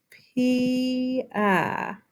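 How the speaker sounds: "Pia" drawn out as PEE-uh; two syllables. Shown as a demonstration. Teacher showing vowels make two syllables, drawn-out pee-uh. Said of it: "constitutes two syllables".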